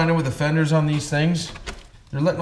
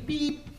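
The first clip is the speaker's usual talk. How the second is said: high beep sound; 12 semitones higher, 7 dB quieter than usual. Pretend. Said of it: "we can just go beep".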